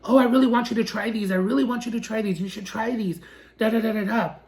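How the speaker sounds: mock a pushy salesman, quick and insistent; pushy mimicry. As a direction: rattle it off like a persistent waiter. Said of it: "so persistent and pushing and pushing".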